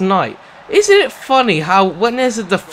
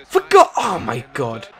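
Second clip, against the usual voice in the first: ordinary talk, nothing marked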